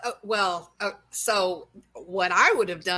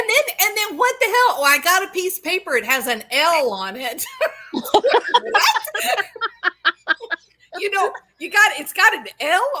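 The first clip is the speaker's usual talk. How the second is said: high, loud laughing rant; laughing disbelief; 9 semitones higher, 5 dB louder than usual. Real, not acted. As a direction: laugh through the outrage. Laughing at absurd evidence.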